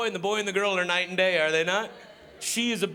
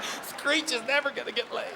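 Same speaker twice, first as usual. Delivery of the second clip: mock tearful; 5 semitones higher than usual. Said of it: "I was like".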